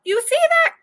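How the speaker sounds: a teacher calling the class's attention; scolding teacher. Acted. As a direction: point it out to the whole class. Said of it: "said the teacher".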